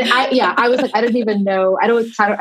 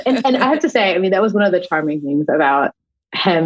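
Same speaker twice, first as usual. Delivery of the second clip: usual speaking voice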